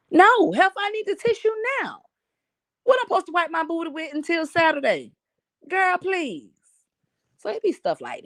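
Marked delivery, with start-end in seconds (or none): 0.1-6.5 high, exasperated disbelief; sassy, pitched-up scoff at the idea; incredulous outrage. Real, not acted. Ranting to viewers about the store's coupon, high and scoffing.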